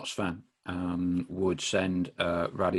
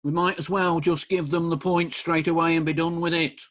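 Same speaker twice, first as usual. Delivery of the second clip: hammed-up Brian Clough impression, higher and louder; Brian Clough accent; 7 semitones higher, 5 dB louder than usual. Host quoting Clough's programme notes about Notts County, in a self-confessed terrible Brian Clough accent. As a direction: pitch it up and belt it out like an East Midlands football manager doing his Brian Clough accent. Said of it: "Forgive the accent".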